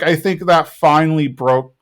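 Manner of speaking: usual speaking voice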